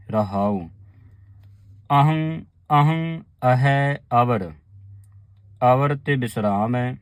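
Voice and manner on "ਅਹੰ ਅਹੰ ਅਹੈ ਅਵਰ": ਅਹੰ with a clear nasal tippi ending, said slowly; nasal tippi sound. Shown as a demonstration. Teacher showing the sangat the tippi sound in ਅਹੰ. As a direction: ring the nasal ਅਹੰ, then ਅਹੈ ਅਵਰ slowly. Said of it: "ਇਸ ਤਰ੍ਹਾਂ ਉਚਾਰਨ ਕਰਨਾ".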